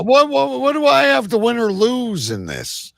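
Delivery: ordinary talk, nothing marked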